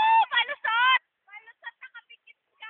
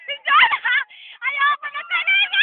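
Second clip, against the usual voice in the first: loud, higher exclamation; excited yelling; 3 semitones higher, 8 dB louder than usual. Real, not acted. Friend refusing to ride ever, excited yelling. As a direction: yell it out, rising to a squeal.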